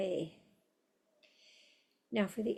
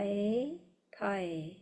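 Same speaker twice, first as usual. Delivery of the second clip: usual speaking voice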